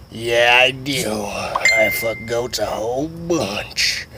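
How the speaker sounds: slow, dragged-out chuckling confession; chuckle, then stretch out the last words; creepy slow character. Retold or imitated. Steve voiced slow.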